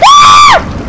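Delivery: very high shriek of fright; piercing scream of real fear; terrified scream. Real, not acted. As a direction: scream as it lunges. Sea creature lunges.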